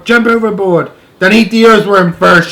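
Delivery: high, loud panicky shout; shouting centipede. A narrator's reading. Centipede shouting to the crew to save himself. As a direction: bark out orders in a rush. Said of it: "shouted the centipede".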